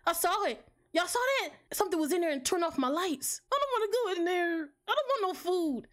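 high, near-crying fright; terrified, voice rising and breaking; scared. Real, not acted. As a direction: whimper it out, pitch up. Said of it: "I'm scared".

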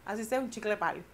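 normal talking voice

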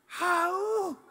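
high little mock yap; playful imitated puppy woof; puppy bark. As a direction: imitate a tiny dog's bark. Dog imitated.

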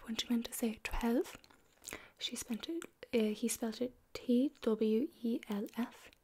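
normal talking voice

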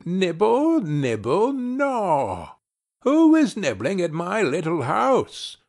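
high, soft crooning voice; soft witch voice. A narrator's reading. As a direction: sweetly call from inside. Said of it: "a soft voice cried".

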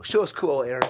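ordinary talk

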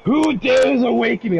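booming ancient king risen from slumber; ancient rasping undead king. Acted. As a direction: proclaim it like a long-dead king waking. Awakened undead king entrance.

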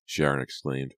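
ordinary delivery

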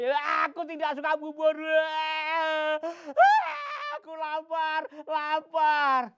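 high loud spoiled whine; whiny child. Acted. Gundul refusing the mother's leftover rice, high whine.

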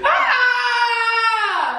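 high cry of pain; pained scream. Real, not acted. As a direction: scream from the heat. Scream after eating spicy wing.

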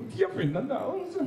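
high, awed gasp of the name; starstruck squeal. Retold or imitated. Fan gushes at meeting Fernando Alonso, high and awed. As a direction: gush the name like a dazzled fan.

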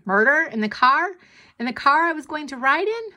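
high, rising incredulous questions; alarmed disbelief. A narrator's reading. Hamster fretting about the car ride, alarmed.

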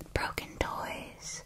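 ordinary delivery, nothing marked

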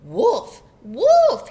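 loud shout, rising to high; storybook false alarm, building; alarmed cry. A narrator's reading. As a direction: cry wolf at full voice. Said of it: "he cried".

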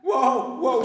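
loud, high frightened whoa; alarmed whoa. Acted. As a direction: cry out to stop it. Man alarmed by horse's verdict.

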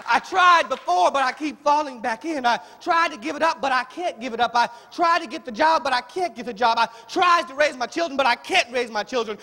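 loud, high, rhythmic desperate confession; impassioned preaching as a struggling believer. Acted. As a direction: pile up each failure with rising urgency.

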